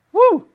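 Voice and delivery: high, loud whoop; excited whoop. Real, not acted. Pastor whoops, thrilled at God's choice, high and loud. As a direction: let out a joyful whoop. Excited outburst mid-sermon.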